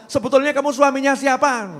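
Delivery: angry wife demanding who he belongs to; mock angry wife. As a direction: scold the husband like a fed-up wife. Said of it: "bojone jek takok".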